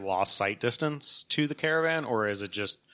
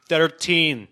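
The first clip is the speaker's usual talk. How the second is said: put-on THIRTEEN in a Scottish accent, raised and firm; imitated Scottish accent; 5 semitones higher, 6 dB louder than usual. Scot gives a voice elevator the floor, Scottish accent. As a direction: say "Thirteen" as a Scot, a bit louder and higher. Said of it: "understand a Scottish accent".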